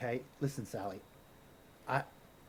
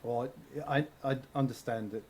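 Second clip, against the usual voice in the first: ordinary delivery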